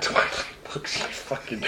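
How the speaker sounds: breathy laughing taunt. Real, not acted. Mocking friend's Twilight reading.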